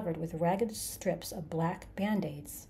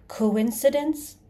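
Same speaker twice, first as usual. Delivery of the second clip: slow, loud, raised pitch; incredulous challenge; 5 semitones higher, 8 dB louder, much slower than usual. A narrator's reading. Creature challenges Arthur's excuse, loudly incredulous. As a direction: throw the word back, drawn out and loud.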